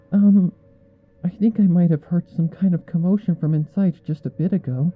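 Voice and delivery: hesitant, meek report of a noise; timid Fluttershy. A narrator's reading. Fluttershy timidly telling the others what she heard. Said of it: "in a voice so quiet I almost can't hear her".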